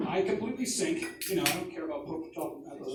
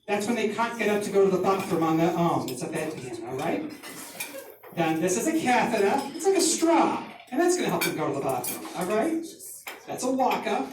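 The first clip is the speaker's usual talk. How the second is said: put-on higher, South Shore accent, teacher explaining; mother's South Shore accent; 3 semitones higher, 3 dB louder than usual. Mother teaching orderlies medical terms, South Shore accent. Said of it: "imagine that in that accent".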